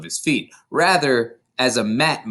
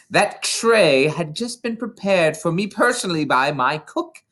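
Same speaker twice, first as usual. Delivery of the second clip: higher, ranting headmistress voice; tyrannical headmistress; 6 semitones higher than usual. A narrator's reading. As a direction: rage at the boy like a tyrant. Headmistress rants at accused boy.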